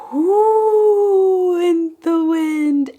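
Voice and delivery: high, slow wind whoosh; howling wind. Acted. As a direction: howl like the wind. Poem wind sound, voiced wind.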